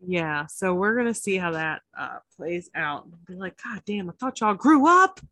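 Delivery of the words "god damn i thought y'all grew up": loud, higher, playful exclamation of disbelief; mock exasperation. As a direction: call them out in mock dismay, voice rising. Acted exasperated reaction to house drama.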